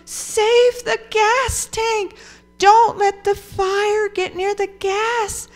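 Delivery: high, drawn-out calling voice; storyteller's yell. A narrator's reading. Mama warning the boys about the gas tank, acted yell. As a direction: call a warning across the farm, acted. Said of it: "And Mama yelled".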